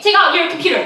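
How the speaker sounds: high, fast mock order; barking TSA agent. Airport agent hurrying a traveler, mock barking. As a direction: rattle off the next command. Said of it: "you know how they're like".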